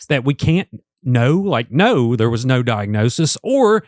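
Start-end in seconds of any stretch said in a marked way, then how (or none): none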